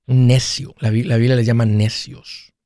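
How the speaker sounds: usual speaking voice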